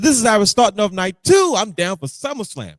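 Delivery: loud, high hyped-up fan cry; excited fan. Retold or imitated. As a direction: shout it with pure hype. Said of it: "I said".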